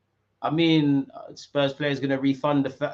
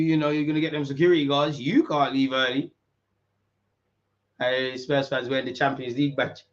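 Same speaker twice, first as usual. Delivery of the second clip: usual speaking voice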